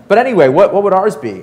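high, loud eager question from a keen board member; loud, high, over-keen boardroom question. Retold or imitated. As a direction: blurt it out, pushing for the number.

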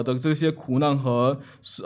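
ordinary talk

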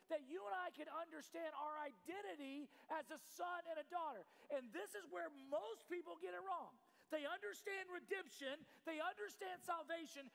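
impassioned, projected sermon delivery; preaching. Real, not acted. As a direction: drive the point home to the congregation. Preaches at full force.

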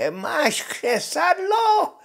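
very high, slow screech of a witch; witch, eager question. Acted. Witch calls down to soldier. (ječivá čarodějnice, vysoko a protáhle)

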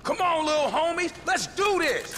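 rally the little homies; rallying shout. Acted. Urging the young crew into the fight, shouting.

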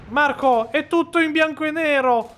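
high loud alarmed cry; alarmed call. Real, not acted. Gamer crying out in alarm to teammate Marco.

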